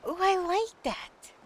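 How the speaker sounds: high, slow delighted ooh. Real, not acted. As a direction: coo with delight. Likes the idea.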